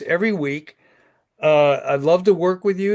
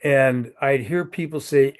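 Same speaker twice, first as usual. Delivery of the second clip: ordinary talk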